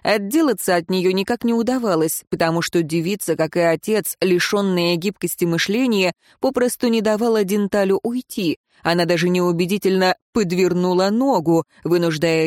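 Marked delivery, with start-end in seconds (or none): none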